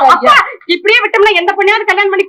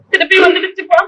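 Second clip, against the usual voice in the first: tearful. Acted. Daughter tells father tearfully.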